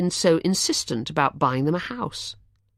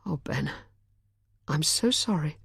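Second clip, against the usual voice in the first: soft, kind sympathy; 5 dB quieter than usual. A narrator's reading. Charlotte comforting Ben after the news, softly kind. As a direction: comfort him gently. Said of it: "kindness in her voice".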